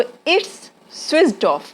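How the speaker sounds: very slow model sentence; slow demo. Shown as a demonstration. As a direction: drill it slowly for pupils. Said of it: "ये तो पहले से ही बंद है".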